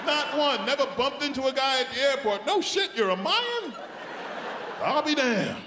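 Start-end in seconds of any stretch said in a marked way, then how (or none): 2.5-5.6 high, hammed-up astonished question; mock surprise. Meeting a Mayan at the airport, with high hammed-up surprise. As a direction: gasp it out like meeting a unicorn.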